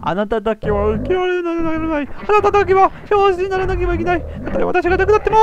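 a betrayed mother lashing out at her daughter; furious mother. Acted. The mother disowning her daughter, high furious voice.

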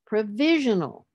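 PROVISIONAL as pro-VI-sion-al; stress on second syllable. Shown as a demonstration. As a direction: swell on VI in provisional. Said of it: "the stress will be on the third from the end".

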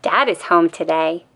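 usual speaking voice

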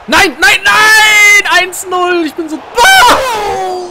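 high, loud despairing screams; shouting in disbelief. Real, not acted. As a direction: scream no over and over. Conceding goal, screaming in disbelief.

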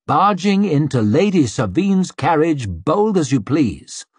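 usual speaking voice

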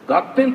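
ガッテン said high and punchy, loud; ガッテン popped out sharp and loud; Kansai-style ガッテン. Shown as a demonstration. Director demonstrating ガッテン, high and loud. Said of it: "という言葉がある".

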